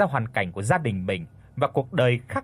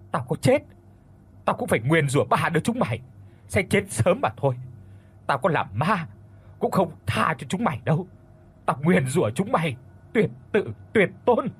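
high-pitched, frenzied curse; screamed curse; 3 semitones higher than usual. A narrator's reading. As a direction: cry out a vengeful oath, pitch raised. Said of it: "cô hét lên".

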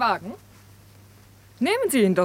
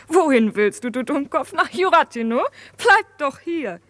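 retold exasperated plea; 4 semitones higher than usual. Retells scolding and pleading with friend.

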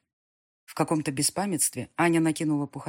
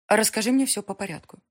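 serious; 5 semitones higher, 5 dB louder than usual. Acted. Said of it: "попросила мама серьёзно".

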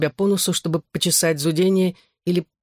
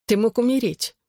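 higher, mildly tearful reproach; tearful mother; 6 semitones higher than usual. A narrator's reading. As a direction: reproach him with a touch of tears. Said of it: "всхлипнула мама". (с лёгкой слезой, укоризненно)

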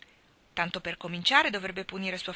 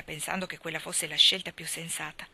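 ordinary delivery, nothing marked

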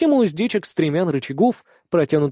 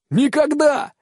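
gleeful shout; 11 semitones higher, slower than usual. Acted. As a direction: fling it back with glee. Kihara shouting back.

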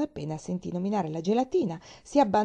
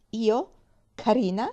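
sour, incredulous echo, raised pitch; 4 semitones higher, 6 dB louder, slower than usual. A narrator's reading. Sick woman hearing she is pretty, sour disbelief. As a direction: question the compliment acidly, a little loud. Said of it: "disse la donna acida".

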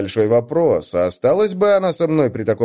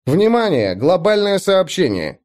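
announcer voice; 7 semitones higher than usual. A narrator's reading. System announcement repeated.

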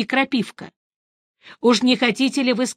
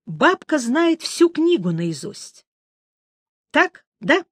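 distrustful; 7 semitones higher, slower than usual. A narrator's reading. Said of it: "недоверчиво сказал Геральт".

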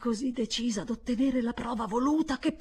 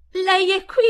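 put-on high, loud frightened boy; anxious question; 11 semitones higher, 12 dB louder than usual. Miles anxiously asking the governess, frightened. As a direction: ask it in a panic. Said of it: "domandò Miles con ansia".

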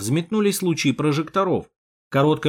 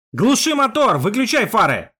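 high, loud shouted order; shouting; 8 semitones higher, 4 dB louder than usual. Acted. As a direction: yell the order. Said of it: "проорал я".

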